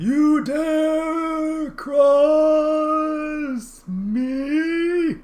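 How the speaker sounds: drawn-out high river spirit; slow booming river voice. Acted. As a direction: speak as an ancient river, stretched slow.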